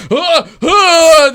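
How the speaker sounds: high loud shrieks; terrified screams. Acted. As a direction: shriek in terror. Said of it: "They're just like".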